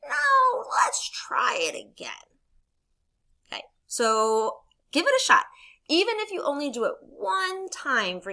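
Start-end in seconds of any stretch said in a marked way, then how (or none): 0.1-2.4 high, drawn-out opening, then slow; insistent storybook frog; Frog character voice. Acted. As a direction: refuse to quit, stretched out. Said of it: "every time Frog responds to Toad".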